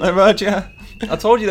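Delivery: high, loud and slow, drawn out; mock panic at the alarm. Host joking the police heard the cohost, hammed-up alarm.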